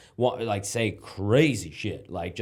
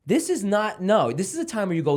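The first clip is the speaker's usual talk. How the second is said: ordinary talk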